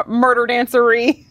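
usual speaking voice

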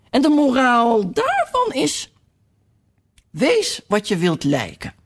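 lightly voiced duchess, slow; pompous duchess pronouncing a moral. A narrator's reading. Duchess lecturing Alice on morals, a mild put-on voice.

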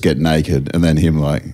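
ordinary delivery

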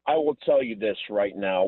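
ordinary talk, nothing marked

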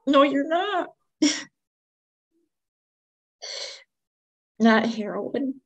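can't believe what she's hearing; stunned disbelief. Retold or imitated. Mother hearing her son's heroin confession, stunned disbelief. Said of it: "I was like".

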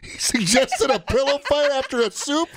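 high disbelieving question; can't believe what you heard; incredulous. Real, not acted. Disbelief at the story.

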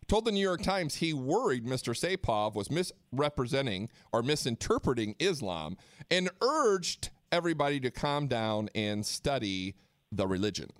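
normal talking voice

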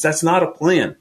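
usual speaking voice

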